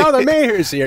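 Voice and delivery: high, sarcastic crowd voice; mock crowd greeting. Crowd jeering the sick mayor, high and mocking. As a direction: chirp it like a fake welcome. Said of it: "They're like".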